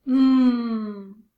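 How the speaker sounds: long sustained "mmm" hum; letter sound demo. Shown as a demonstration. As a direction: hum the M sound, firm and drawn out. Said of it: "I'm gonna give a sound".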